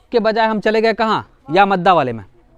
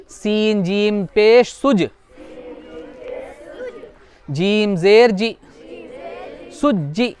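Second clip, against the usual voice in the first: spelling drill; much slower than usual. Shown as a demonstration. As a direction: chant each letter slowly for the class. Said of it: "उसके बाद देखो".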